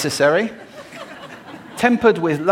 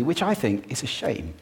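ordinary delivery

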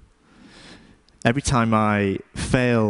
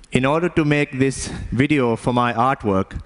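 put-on Indian-accented storytelling; measured Indian-accented English. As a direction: speak as an Indian immigrant father would, Indian accent. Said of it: "have an Indian accent anymore".

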